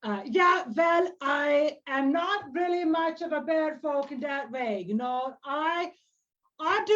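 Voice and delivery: high elder voice, dismissive; old bear-folk druid. Acted. Old druid says she is not much of a bear folk, dismissive. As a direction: wave off the idea in a high character voice.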